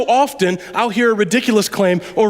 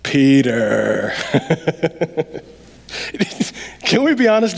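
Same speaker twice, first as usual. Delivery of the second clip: usual speaking voice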